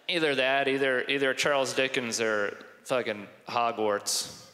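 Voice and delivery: usual speaking voice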